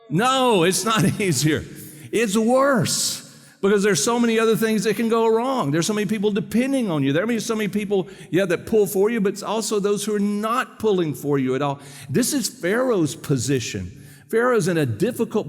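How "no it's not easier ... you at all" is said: high, animated preacher's outburst; emphatic exclamation. Real, not acted. Preacher answering his own question about parenting, emphatic. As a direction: exclaim it emphatically, pitch lifted well up.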